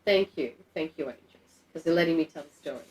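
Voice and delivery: normal talking voice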